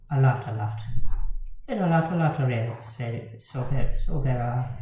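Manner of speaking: quick, lilting mock Irish accent; playful put-on Irish accent, rattled off fast. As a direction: toss it off in a jokey Irish accent. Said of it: "I'm gonna stop doing an Irish accent now".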